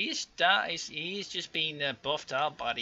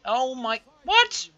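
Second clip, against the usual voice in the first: shocked outburst; 11 semitones higher, 12 dB louder, slower than usual. Real, not acted. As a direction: pitch leaps high and loud on what. Shocked by a sudden rebellion.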